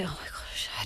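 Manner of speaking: panicked inner whisper. Retold or imitated. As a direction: whisper it to yourself. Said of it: "and I'm just like".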